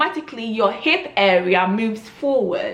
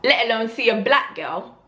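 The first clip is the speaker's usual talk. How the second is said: ordinary talk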